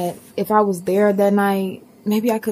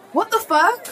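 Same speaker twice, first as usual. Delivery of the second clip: shocked outburst; 13 semitones higher than usual. Acted. Seeing something in the mirror.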